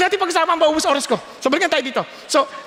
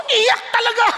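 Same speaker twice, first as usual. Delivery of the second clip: usual speaking voice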